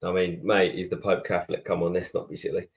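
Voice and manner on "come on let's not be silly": usual speaking voice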